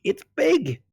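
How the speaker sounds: sudden high excited burst. Real, not acted. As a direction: blurt it out thrilled. Excited about crocodile size.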